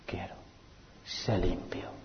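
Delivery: tender whisper; whispered answer. Acted. Jesus heals the leper with a whisper. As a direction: breathe the words like a blessing.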